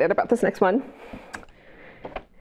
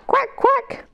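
duck quack. Shown as a demonstration. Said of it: "what do ducks say".

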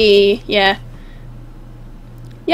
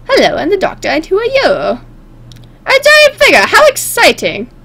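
hammed-up, terrible impersonation with swooping pitch; the Doctor; 6 semitones higher than usual. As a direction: swing the pitch about theatrically, badly imitated. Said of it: "all these impersonations are gonna be terrible".

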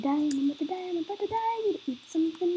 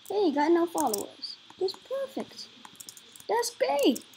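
normal talking voice

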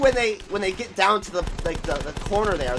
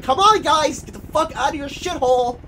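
lightly voiced mock barking of a character, higher and loud at the start; 8 semitones higher, 6 dB louder than usual. Gamer mimicking a game character, lightly hammed up. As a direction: toss off a hammed-up order. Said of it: "He's like".

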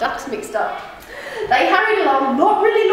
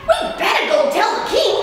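higher urgent duck voice; 9 semitones higher, faster than usual. Acted. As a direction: hurry them along. Ducky Lucky urges in story.

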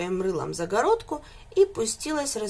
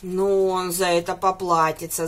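ordinary delivery

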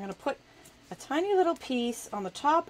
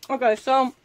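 usual speaking voice

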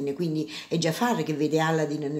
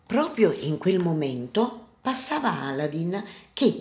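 usual speaking voice

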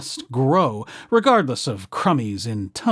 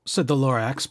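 ordinary delivery, nothing marked

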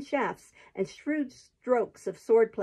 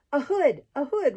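high, loud rallying call; roared battle cry; 5 semitones higher, 4 dB louder than usual. A narrator's reading. Giant leads the charge on the soldiers, roaring. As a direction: roar out the war cry, short of full force. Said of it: "roared a gigantic man".

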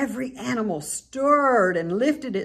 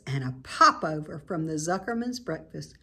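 ordinary delivery

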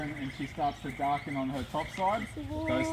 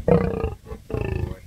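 high-pitched praise; 5 dB louder than usual. Real, not acted. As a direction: praise the lion in a squeal. Keeper praising lion, very high.

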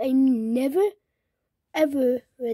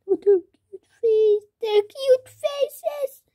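higher, louder cooing over cute faces; gushing; 10 semitones higher, 6 dB louder than usual. Real, not acted. Girl gushes to viewers about cute toys, cooing. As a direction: coo like you just saw a puppy.

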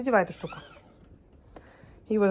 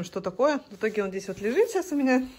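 ordinary talk, nothing marked

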